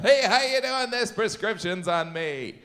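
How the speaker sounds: high, loud, gushing friendly greeting; overly nice pharmacist. Acted. Pharmacist greeting a customer in a commercial, high and super friendly. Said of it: "the nicest guy on earth".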